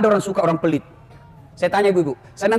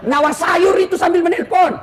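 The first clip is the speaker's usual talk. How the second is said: exasperated; 8 semitones higher, 3 dB louder than usual. Real, not acted. Exasperated complaint about women drivers.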